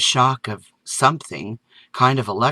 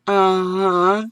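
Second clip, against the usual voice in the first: put-on higher boyish mumble; mumbling; 8 semitones higher than usual. A narrator's reading. Son answers his mother, boyish mumble. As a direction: mumble it like a shy kid. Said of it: "while mumbling".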